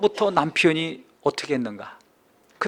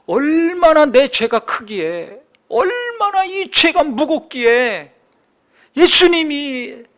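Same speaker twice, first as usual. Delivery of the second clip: high, loud, slow, drawn-out lament; impassioned preaching; 12 semitones higher, 8 dB louder, much slower than usual. Acted. Preacher grieving over his own sin, impassioned. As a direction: stretch each phrase out at full preaching force.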